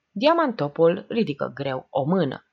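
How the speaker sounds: ordinary delivery, nothing marked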